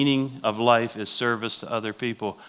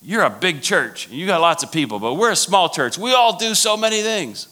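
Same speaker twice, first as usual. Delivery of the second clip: high whiny protest, climbing and louder; upset church lady; 7 semitones higher, 4 dB louder than usual. Retold or imitated. Small-church lady protesting to big-church leader, increasingly upset. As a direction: build her complaint to a high, loud finish. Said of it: "She was really upset".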